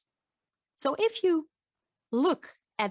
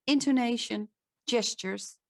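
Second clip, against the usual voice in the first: ordinary talk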